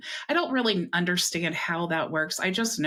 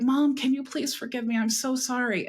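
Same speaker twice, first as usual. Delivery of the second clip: higher-pitched, earnest apology; retold pleading; 7 semitones higher than usual. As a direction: act out begging a mother's forgiveness. Retells begging mother's forgiveness.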